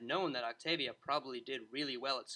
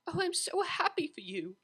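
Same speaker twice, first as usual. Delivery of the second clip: put-on higher, delighted young mare; Octavia's voice; 14 semitones higher, 3 dB louder than usual. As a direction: gush it with joy as the cellist. Octavia hugs Vinyl.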